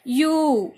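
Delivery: यू drawn out as a clear "yoo"; yoo sound. Shown as a demonstration. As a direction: glide into यू like "you". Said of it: "इस तरह से यू". ("यू" को "यू" की तरह खींचकर)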